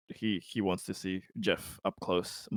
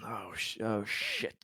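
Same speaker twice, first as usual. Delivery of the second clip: dawning dread; 7 dB quieter than usual. Acted. Captain realizing what happened to the other ship, quiet dread. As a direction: let the curse sink out softly. Character realizing danger, under breath.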